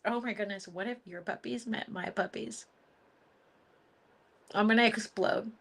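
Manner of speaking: usual speaking voice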